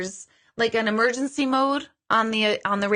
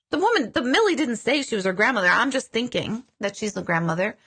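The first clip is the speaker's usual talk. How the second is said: usual speaking voice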